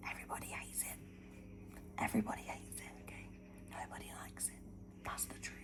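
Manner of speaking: real early-morning whisper. Real, not acted. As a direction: breathe the words with no voice. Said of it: "I don't know why I'm whispering".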